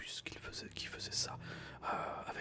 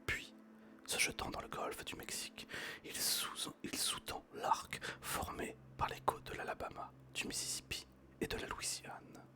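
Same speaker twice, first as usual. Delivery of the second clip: whispered story reading; 11 semitones lower than usual. Acted. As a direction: read the story gently in a whisper. Reads Jules Verne aloud whispering.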